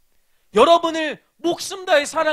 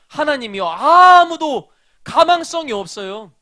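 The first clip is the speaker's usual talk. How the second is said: 아무도 stressed louder and slow; emphatic; 4 semitones higher, 7 dB louder, slower than usual. Acted. Preacher stressing to students that nobody had hope. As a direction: lean hard on 아무도, louder and slower. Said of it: "preacher emphasizing".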